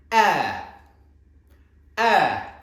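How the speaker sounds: high, loud call upwards; said to the ceiling. Shown as a demonstration. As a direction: send the sound up to the ceiling. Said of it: "say it to the ceiling".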